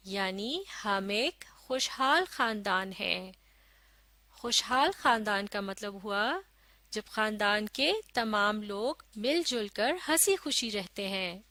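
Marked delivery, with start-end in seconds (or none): none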